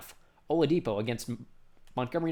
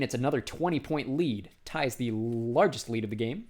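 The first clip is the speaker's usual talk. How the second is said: normal talking voice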